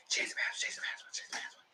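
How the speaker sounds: frantic repeated whisper; urgent whisper. Retold or imitated. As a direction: hiss it to yourself in a panic. Acting out frantic password change.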